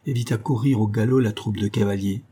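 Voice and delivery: plain reading voice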